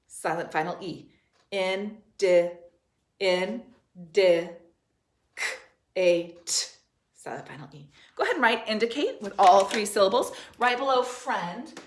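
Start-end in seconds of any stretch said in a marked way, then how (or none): 1.5-6.7 "In, D, K, A, T" in slow separate pieces; sounding out. Shown as a demonstration. Teacher building indicate piece by piece, sounding out. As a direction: break "In, D, K, A, T" apart with pauses. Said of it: "see how many syllables we have".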